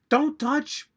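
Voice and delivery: high, loud mock action-hero warning; Jack Slater warning. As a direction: yelp the warning in a put-on voice. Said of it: "and then Jack Slater goes".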